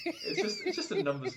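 laughing. Real, not acted. Laughing at own joke.

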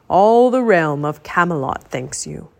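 put-on slow, stately old wizard; wise old wizard. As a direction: speak like a grave old wizard. Merlin speaking formally.

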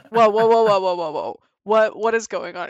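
loud alarmed whoas; retold alarmed stop. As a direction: halt him fast with a burst of whoas. Stopping him mid-act.

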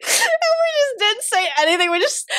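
high voice, full of giggles; laughing while telling. Real, not acted. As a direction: squeeze the words out through laughter. Still laughing at the story.

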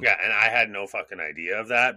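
ordinary talk

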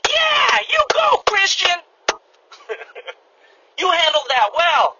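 very high cheering shout, then slow praise; cheering crowd. Retold or imitated. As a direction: shout like bystanders cheering you on. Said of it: "everybody around was like".

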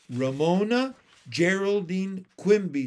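loud full-name reprimand; bossy big sister, each name set apart; stern scolding. A narrator's reading.

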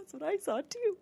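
high-pitched retold excitement; excited agreement. Friend agrees about the shadow, high and excited. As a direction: squeak it out as if confirming a sighting.

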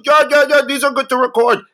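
hammed-up imitation of an eager store clerk's accent; [name]'s mock accent. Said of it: "Yo, you sound just like him".

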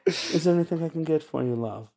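high, breathy flirtatious purr; breathy vintage purr. Pretend. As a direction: coo it like an old-time siren. Voicing seductive dragon character.

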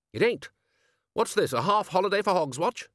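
put-on loud, high, hearty old wizard; hearty bellowing wizard; bluff Ridcully voice. A narrator's reading. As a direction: boom it like a blustering archchancellor.

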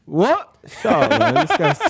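laughing high and loud; high shocked laugh through words. Real, not acted.

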